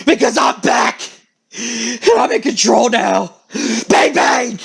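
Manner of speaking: rough, rasping voice of menace; menacing alter ego. Acted. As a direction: announce your takeover in a harsh rasp. Acted menacing personality takes over.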